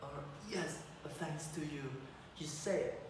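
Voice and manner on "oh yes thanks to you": softer, dazed gratitude; barely recovered, murmured thanks; weak and quiet. Acted. Knocked-out prince thanks Clara, soft and weak.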